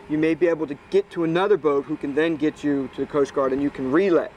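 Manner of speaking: ordinary delivery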